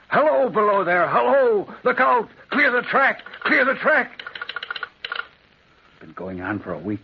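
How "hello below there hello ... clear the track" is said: high, loud, frantic warning cry; a signalman mimics the ghost's frantic calls; frantic shout. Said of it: "he shouts frantically".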